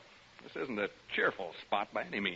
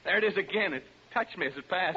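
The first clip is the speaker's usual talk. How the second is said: normal talking voice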